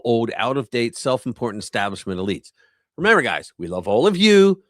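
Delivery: punchy, animated commentary read; article narration. Acted. Streamer reading political commentary to viewers, lively emphatic narration. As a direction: read it out with emphatic swings in pitch.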